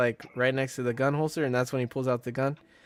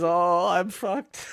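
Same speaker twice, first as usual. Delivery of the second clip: slow mock dread; 4 semitones higher, much slower than usual. Retold Martin realizing trouble.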